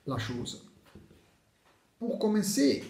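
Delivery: normal talking voice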